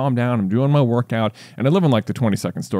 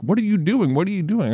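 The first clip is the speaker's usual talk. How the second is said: mock yell; 8 semitones higher than usual. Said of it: "yelling at me the whole time".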